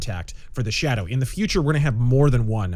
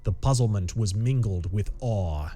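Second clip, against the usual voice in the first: story narration; 5 semitones lower than usual. A narrator's reading. Narrator unfolding a tense pulp mystery, measured story narration. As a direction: read it deliberately, with suspense between phrases.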